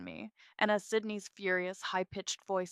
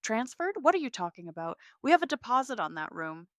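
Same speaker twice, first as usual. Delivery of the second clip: higher and louder in alarm; rising alarm; 5 semitones higher, 6 dB louder than usual. Acted. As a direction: push the voice up in panic. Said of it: "his voice rising in alarm".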